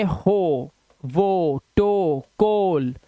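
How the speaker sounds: "हो, वो, टो, कोल" slowly with long oh; drawn-out word list, "टो" like toe. Shown as a demonstration. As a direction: hold the oh in each of "हो", "वो", "टो". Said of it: "ओ की आवाज".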